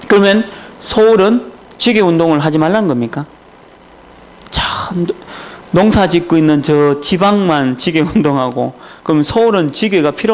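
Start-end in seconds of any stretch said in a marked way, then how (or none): 4.5-5.1 a whispered sigh under the breath, softer; breathy aside. Real, not acted. Preacher muttering in disbelief at excuses, whispered sigh. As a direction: let one word slip out on a breath.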